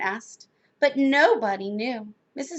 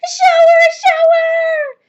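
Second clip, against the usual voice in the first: very high, loud squeal; children screaming; 12 dB louder than usual. A narrator's reading. Four children jumping into the shower, screaming. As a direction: shriek it like thrilled children. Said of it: "screamed Missus Ralph's four children".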